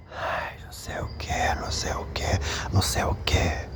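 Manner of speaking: breathy mock whisper of a spirit. Spirits whispering into the men's ears, voiceless whisper. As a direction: hush it right into the ear, voiceless. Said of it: "esses espíritos sussurram".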